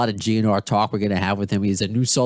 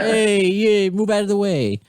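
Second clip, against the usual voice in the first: put-on New York accent; 10 semitones higher than usual. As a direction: shove past like a New York local, New York accent. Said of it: "I'm so used to being in New York".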